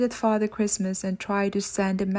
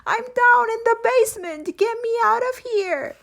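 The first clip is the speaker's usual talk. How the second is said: much higher, louder frantic shriek; screaming wail; 14 semitones higher, 3 dB louder than usual. Acted. Locked Creeper screaming for Mister Hobble, frantic shrieking. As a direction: shriek for help, wild with hatred. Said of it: "screamed a wail of hatred".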